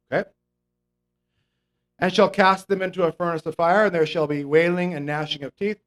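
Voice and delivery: ordinary delivery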